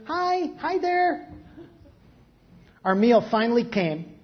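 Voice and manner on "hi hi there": put-on high, slow baby voice copying the man; a tiny baby answering the stranger, high and slow; baby babble. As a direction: babble it back like a happy baby. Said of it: "continued to laugh and answer".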